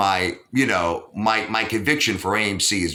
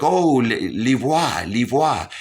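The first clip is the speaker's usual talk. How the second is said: put-on a light French accent from the doctor saying the name; 2 semitones higher, slower than usual. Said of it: "she's like".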